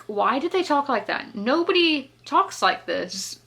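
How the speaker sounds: exasperated disbelief. Retold or imitated. Exasperated at how characters talk.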